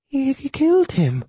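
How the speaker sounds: high, slow, quavering widow. A narrator's reading. Widow repeats her shock to the killer, quavering. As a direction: draw it out high with a fearful quaver. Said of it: "Her voice quavered".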